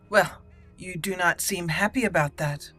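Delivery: ordinary talk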